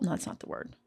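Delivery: under-breath self-correction; whispered aside. Real, not acted. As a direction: mutter it to yourself, half whispered.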